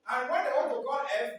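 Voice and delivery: ordinary talk, nothing marked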